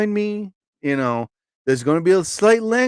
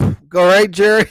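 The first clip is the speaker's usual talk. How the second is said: ordinary delivery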